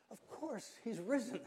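high, giddy burst of joy; joyful. Retold or imitated. Preacher recalling his joy at the Easter service, giddy and high. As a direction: grin through the words, delighted. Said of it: "silly grin of joy".

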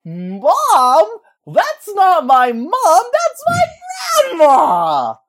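put-on very high, loud kid shriek; hammed-up squealing protest; mock child's outburst. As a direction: yell it like an indignant little kid. Retelling own outburst at age ten.

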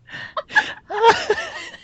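shrieking laugh, close to tears; helpless squealing laugh; high squealing laugh. Real, not acted. Cracking up over the Mummy.

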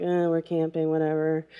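low-key, softened shrug of indifference; unimpressed teen. Retold or imitated. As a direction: toss it off like a bored teenager. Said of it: "we were just kinda like".

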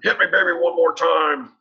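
mock menacing yell. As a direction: bellow a pop lyric as a threat. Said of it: "was all like".

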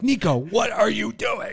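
high, slow, loud call of the son's name. Retold or imitated. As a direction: stretch it out like a fed-up dad. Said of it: "I'm like".

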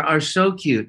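ordinary talk

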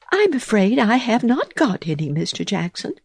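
high, polite little mouse voice; a prim mouse declining her guest; Missus Tittlemouse. A narrator's reading. Tidy mouse answering the greedy toad, high and polite. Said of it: "said Missus Tittlemouse".

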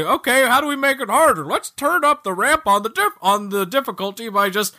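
lazy developer, high and quick at first, then settling; mock lazy developer. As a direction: parody a lazy game designer brainstorming. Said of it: "doesn't just say".

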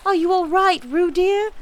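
high, loud motherly call; anxious calling. A narrator's reading. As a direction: call out in worry to your child. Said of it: "called Kanga anxiously".

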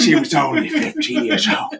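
high Cockney quote through laughter; laughing impression. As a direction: crack up mid-impression.

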